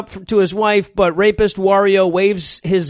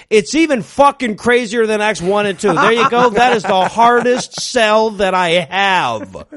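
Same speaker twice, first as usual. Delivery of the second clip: mock hard sell. Host selling Act Three before the break, mock hard sell. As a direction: push it like an over-the-top salesman. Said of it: "give Act Three the hard sell".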